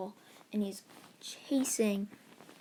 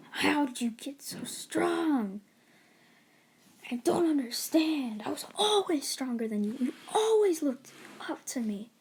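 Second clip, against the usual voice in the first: desperate disbelief; 5 semitones higher, 4 dB louder than usual. Acted. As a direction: plead it out, can't believe it. Beaten rival in disbelief.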